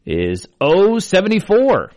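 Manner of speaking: slow, drawn-out number calling; bingo caller. Acted. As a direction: call each number clearly, then repeat. I will be your bingo caller.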